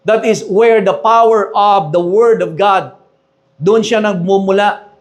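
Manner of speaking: normal talking voice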